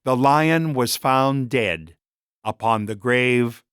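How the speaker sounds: plain reading voice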